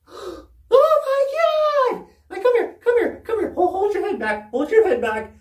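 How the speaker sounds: high, urgent and frantic; a teacher panicking over a bloody nose; alarmed teacher. Retold or imitated.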